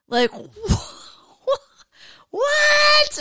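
high shrieked laugh into what; cracking up at his own blunder; laughing disbelief. Real, not acted. To the listeners, laughing at his blunder, high shriek.

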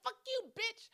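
very high, shrieking insult; mock insult. Cousin cussing back, high mock shriek. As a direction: hurl it back like a bratty kid. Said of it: "She's like".

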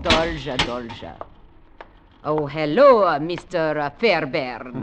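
slow, halting put-on Russian accent; fake Russian accent. Susan greets Fairbairn, posing as Russian, in a fake Russian accent. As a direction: play an American woman faking a Russian accent. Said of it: "What happened to your Russian accent?".

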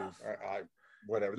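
words fail, a bare whisper; whisper, lost for words; real speechless hush; stunned whisper. Real, not acted.

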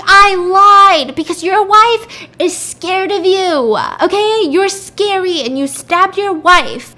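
high, loud, drawn-out outburst; angry accusation. Retold or imitated. Woman accusing husband of stabbing wife, high loud outburst. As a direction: hurl it at him, stretching words loudly.

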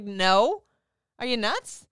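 loud, disbelieving no; mock-outraged retort; incredulous refusal. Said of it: "I was like".

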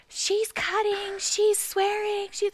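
high, slow, loud tattling wail; mock a scandalized woman yelling for staff; hammed-up shrieky complaint, drawn out; imitated yelling. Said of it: "And she starts yelling".

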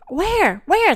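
high, quick questioning yelps; puzzled searching. A narrator's reading. Fluffy hunting for the Great Bear, puzzled. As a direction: ask it high, each word dropping sharply.

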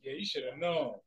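usual speaking voice

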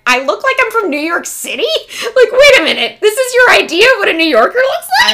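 loud, high, laughing disbelief; incredulous mock outrage. Mom reacting to Virginian's compliment, loud incredulity. Mom's astonished reaction retold.

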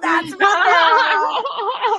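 drawn-out, laughing shout of pride; mock-proud mom bellowing at her funny kid; proud cheer. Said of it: "I'm like".

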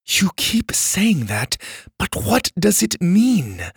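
put-on slow, high-pitched exasperated girl; impatient. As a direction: draw it out with impatience. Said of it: "Mora said impatiently".

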